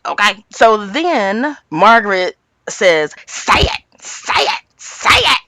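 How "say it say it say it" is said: harsh whispery repeated demand; half-whispered, insistent mock goading; bullying prod. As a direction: imitate a bully pressing for an answer. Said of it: "this is what I'm talking about with bully behavior".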